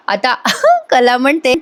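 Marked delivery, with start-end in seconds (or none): 0.4-0.8 sudden high-pitched giggle; can't stop laughing at the story. Real, not acted. Narrator laughing mid-recap. (उंच आवाजात खुदकन हसणे)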